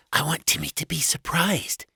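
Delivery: high-pitched conspiratorial wife; high, coaxing wife voice; eager wife. A narrator's reading. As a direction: confide it in a high voice, rising at the end. Wife confides in puppy.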